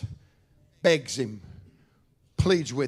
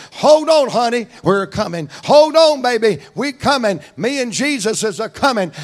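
louder, higher shouts on hold on, then easing; urgent calling out; 6 semitones higher, 5 dB louder than usual. Retold or imitated. Jairus calling to his daughter while rushing Jesus home, urgent shouts. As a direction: call ahead to the sick girl, hurrying. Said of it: "I believe he's a saying".

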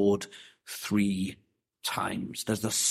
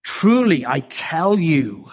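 "Truly" rung out high and loud, "I tell you" slow; a solemn, ringing declaration; solemn force; 4 semitones higher, 5 dB louder, slower than usual. Retold or imitated. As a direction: proclaim it with full solemn weight. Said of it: "The solemn force should have been".

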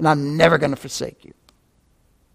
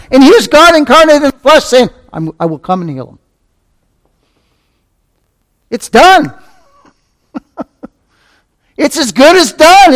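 high, loud, rising fervour; fervent preaching; 7 semitones higher, 6 dB louder than usual. Real, not acted. Preacher exulting to his flock, fervent and loud. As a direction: preach it out with mounting joy.

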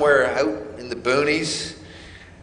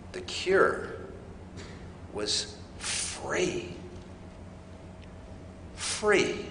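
hushed emphasis; 12 dB quieter, much slower than usual. Real, not acted. Dramatic sermon point.

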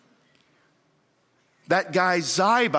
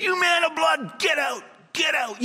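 angry curse; 8 semitones higher than usual. Acted. Preacher voicing Shimei cursing David, shouting. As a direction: hurl curses at the fleeing king.

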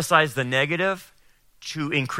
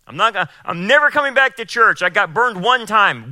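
mock whiny complaint, higher, louder and fast; hurt churchgoer; 7 semitones higher, 5 dB louder than usual. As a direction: imitate a wounded churchgoer swearing off church.

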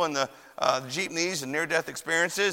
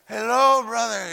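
strained greeting; 5 semitones higher, slower than usual. Retold or imitated. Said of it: "I sort of put a little grit in there".

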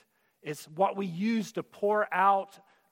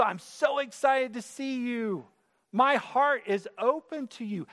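gushing Aunt Bertha; 7 semitones higher than usual. Retold or imitated. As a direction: throw your arms open like a doting aunt. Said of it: "a big-hearted love is Aunt Bertha".

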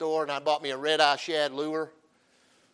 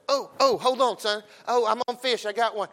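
excited; 5 semitones higher than usual. Retold or imitated. Fish on while son calls.

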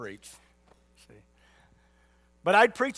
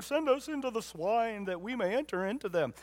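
pleading devils; 5 semitones higher than usual. Acted. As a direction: voice the devils begging in a raised pitch. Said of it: "That's how they sounded".

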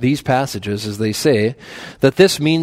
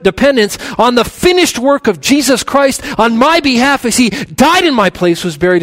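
rising, louder preaching at full force; building sermon climax; impassioned preaching; 8 semitones higher, 5 dB louder than usual. Real, not acted. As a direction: preach it with mounting fervor.

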